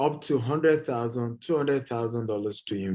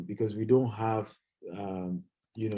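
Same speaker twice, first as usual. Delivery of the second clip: usual speaking voice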